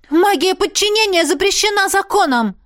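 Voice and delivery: high, loud indignation; indignant outburst. A narrator's reading. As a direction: protest hotly. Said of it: "возмутилась". (возмущённый выкрик)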